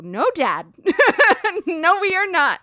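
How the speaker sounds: put-on high, loud teenage refusal; imitated daughter protesting, with a laugh; loud objection breaking into laughter; mock protest. Acted refusal to dad, laughing.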